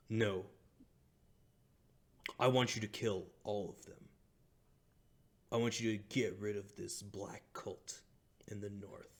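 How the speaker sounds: forceful, controlled order with some anger left. Acted. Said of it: "getting angrier and angrier".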